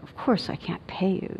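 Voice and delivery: soft, condescending gentleness; quiet, patronizing kindness; gently superior. Retold or imitated. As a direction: talk down softly, as if to a child. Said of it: "being kind of gentle and a little bit like".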